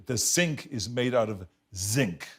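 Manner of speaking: slow, with S in "sink" and buzzed Z in "zinc"; S versus Z drill. Shown as a demonstration. Repeating coach's drill, "sink" with S, "zinc" with Z. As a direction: set hissed "sink" against voiced "zinc". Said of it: "So I was just repeating this".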